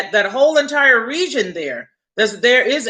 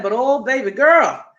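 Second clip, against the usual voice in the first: normal talking voice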